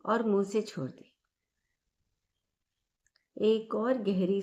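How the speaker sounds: calm, measured meditation guide with long pauses; guided meditation. Acted. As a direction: lead listeners gently through each breath, leaving space after every phrase. (शांत, ठहराव भरी ध्यान कराने वाली आवाज़)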